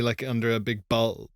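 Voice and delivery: usual speaking voice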